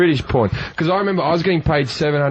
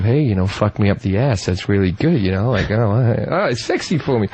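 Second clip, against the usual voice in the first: lower, lightly put-on American porn accent; 6 semitones lower than usual. Mocking American porn talk for the podcast hosts, lower American accent. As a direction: purr it like a classy American porn star. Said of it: "at least with the accent".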